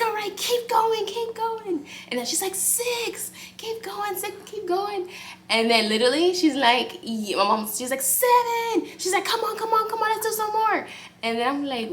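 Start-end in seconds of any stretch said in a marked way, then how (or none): 0.1-5.1 much higher, slowed cheering voice; cheering mom. Retold or imitated. Mom cheering contraction numbers, high-pitched encouragement. 8.0-10.9 much higher, slowed cheering voice; cheering mom. Retold or imitated. Mom cheering contraction numbers, high-pitched encouragement.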